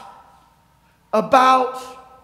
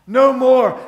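loud, forceful "no more"; raised voice, driving it home; emphatic declaration; 7 dB louder than usual. Real, not acted. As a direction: proclaim it firmly to the room. Repeats it with force.

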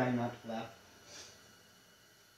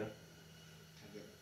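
1 whispered to self; 4 semitones lower, 16 dB quieter, much slower than usual. Real, not acted. Trying not to laugh, whispered.

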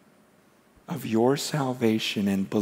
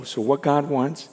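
normal talking voice

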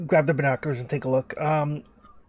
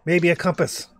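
mildly raised calling voice; half-shouted request across the distance; shouting from afar; 5 semitones higher, 5 dB louder than usual. Acted. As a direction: project it to someone far off. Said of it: "shouts loudly".

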